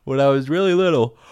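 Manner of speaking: ordinary delivery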